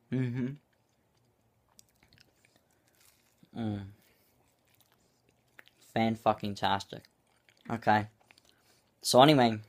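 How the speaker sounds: chewing while talking; muffled words between bites; mouth full of food. Real, not acted. Seen 2: Puts food in mouth at -1, visibly chewing with closed lips throughout.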